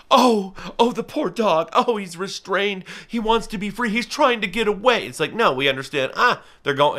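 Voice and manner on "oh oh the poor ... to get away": high, pitying passerby voice, much higher; mock pitying onlooker. Falconer mocking a passerby who pities a leashing dog, high and pitying. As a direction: coo over the poor restrained dog. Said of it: "Nobody's like".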